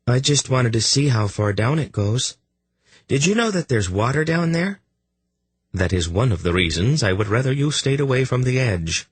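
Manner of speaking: put-on light curious child voice; boy voice. Errand voiced higher.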